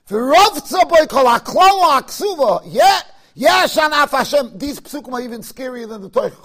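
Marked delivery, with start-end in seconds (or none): 0.1-4.6 loud, slow, high proclamation of the verse; shouted verse. Acted. Rabbi proclaiming the frightening verse aloud, shouting slowly. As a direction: bellow the verse like a warning.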